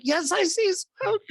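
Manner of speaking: very high, slow mock-panicked squeal; hammed-up panic. Caller answers dispatcher about smoke, hammed-up high panic. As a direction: squeal it out slowly, far above your voice.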